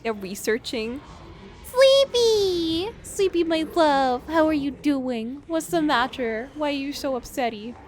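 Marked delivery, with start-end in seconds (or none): 1.6-2.9 high, loud happy call; excited call. Real, not acted. Calling to pet character. 3.0-7.8 high cooing pet talk. Real, not acted. Baby talk to pet character.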